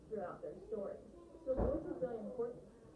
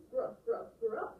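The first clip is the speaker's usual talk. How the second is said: loud little bursts, rising at the end; bleating young goat; 3 semitones higher, 7 dB louder, slower than usual. Acted. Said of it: "bleated the youngest billy goat".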